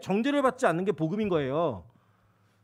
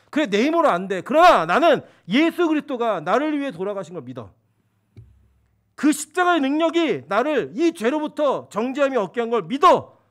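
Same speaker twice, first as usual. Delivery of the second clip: louder, building to a loud, certain finish; bold confession; 3 semitones higher, 7 dB louder than usual. Retold or imitated. Believer boldly declaring faith before the Lord, loudly. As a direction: proclaim it with growing conviction.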